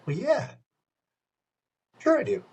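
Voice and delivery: high, breezy bluff; faking confidence. Retold or imitated. Narrator bluffing to the N sync singer, high breezy confidence. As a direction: play it cool, pretending to know her.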